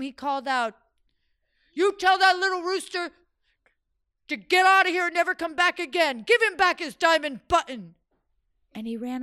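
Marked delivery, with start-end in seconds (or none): 1.7-7.9 higher, louder, fast shouted order; fleeing sultan yell. Acted. Stung sultan yelling to his servants while fleeing. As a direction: yell it over your shoulder while running.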